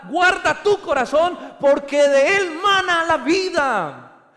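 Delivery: loud, high preaching to the congregation; full-force preaching. Acted. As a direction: proclaim it with full fervour.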